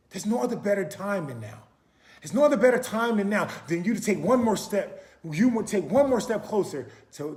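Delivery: loud, high, driving preacher voice; forceful preaching. Acted. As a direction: preach it out to the crowd. Preaching climax.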